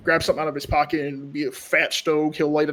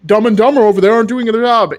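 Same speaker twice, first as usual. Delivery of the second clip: loud, high, heated complaint; Jonathan's raised temper; 7 semitones higher, 10 dB louder than usual. Acted. Gang leader blaming the guards to the Marshal, angry. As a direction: throw the blame loudly and angrily. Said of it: "Jonathan definitely raise his temper and anger".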